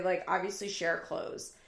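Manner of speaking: ordinary talk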